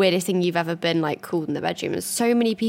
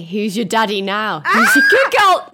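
hammed-up sultry daddy line, rising to a laughing shout; mock seductive, breaking into a laughing shout; mock sexy voice note; 2 semitones higher, 9 dB louder, slower than usual. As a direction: read it like a cringe sexy voice note.

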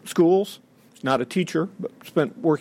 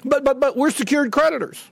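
higher, stammering mock protest from the banks; mock banker protest; 7 semitones higher than usual. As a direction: sputter it like a caught-out banker. Banks protesting, mocked by speaker.

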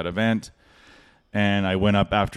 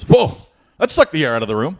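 breezy emcee quip; 6 semitones higher, 4 dB louder, faster than usual. Retold or imitated. MC retorting after sad story.

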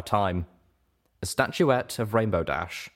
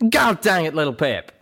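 loud, exasperated outburst with raised pitch; angry growl; 9 semitones higher, 6 dB louder than usual. A narrator's reading. Calamity answers Little Pip's sobbing apology, loud and angry. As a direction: snap back angrily. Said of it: "Calamity growled back angrily".

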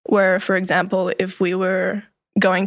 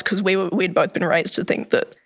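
normal talking voice

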